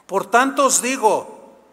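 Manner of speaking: loud, high scripture reading; forceful preaching. Real, not acted. Pastor proclaiming Mark 11:24 to the congregation, fervent. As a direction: proclaim it to the whole church.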